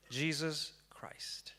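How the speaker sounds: slow, hushed whisper of the name; awed whisper. Acted. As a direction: breathe the name out slowly, in awe. Names the role of a lifetime.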